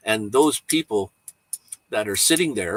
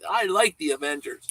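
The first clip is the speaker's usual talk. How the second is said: lightly voiced little kid, higher; higher kid voice, without the shouting; imitated little kid; 7 semitones higher than usual. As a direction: quote the kid at normal volume. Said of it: "He looks and he said".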